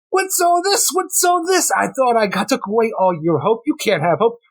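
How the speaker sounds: high, hammy villain voice; sneering high cartoon villain. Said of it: "And then there's Insomnia".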